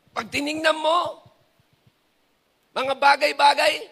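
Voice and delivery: ordinary talk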